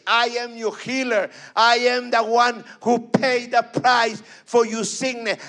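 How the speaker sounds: loud, fervent preaching; fervent sermon building to a shout. Acted. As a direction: preach it out with full force. Preaching to a congregation.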